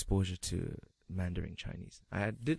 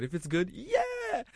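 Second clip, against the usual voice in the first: excited cheer; 3 dB louder, slower than usual. Retold or imitated. Cheering a good food gamble.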